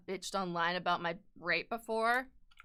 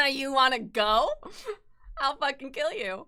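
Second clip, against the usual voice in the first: mock threat; 5 semitones higher, 4 dB louder than usual.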